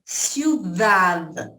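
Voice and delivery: ciudad, slow, the final d barely sounded; silent final d. Shown as a demonstration. Teacher points the class to ciudad, slow and careful. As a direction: stretch out ciudad and swallow the d. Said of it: "Ciudad termina com D mudo".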